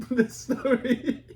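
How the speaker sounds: laughing through the words. Real, not acted. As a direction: break into a slow laugh. Laughs mid-answer about story.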